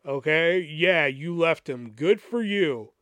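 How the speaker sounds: slow, deliberate, a touch sarcastic; slow pointed reading. Retold or imitated. Reading his reply to the estranged friend aloud, slow and sarcastic. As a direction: lay each word down slowly, half mocking. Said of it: "I'm just like".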